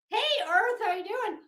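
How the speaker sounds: a teasing, cheesy hello to the viewers; mock cheery greeting. Mocking her own commentary with a high sing-song greeting.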